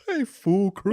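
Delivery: mock would-be cool greeting, high; Santa Claus. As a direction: imitate a Santa trying to be cool. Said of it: "how he would sound trying to be cool".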